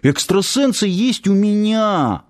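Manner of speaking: high, drawn-out insistent cry; acted eager outcry; urgent exclamation. A narrator's reading. Youth blurts out his claim.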